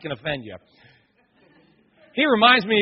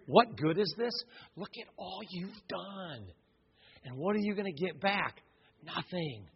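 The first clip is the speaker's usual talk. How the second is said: much softer, hushed tempting voice; devil's whisper; 12 dB quieter than usual. Retold or imitated. The devil whispering doubt to a believer, hushed. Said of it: "You ever have that whisper in your ear?".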